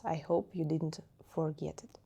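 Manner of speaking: an unvoiced whisper; soft whispered aside to students. Real, not acted. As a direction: drop to a whisper for a moment. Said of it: "whispered aside".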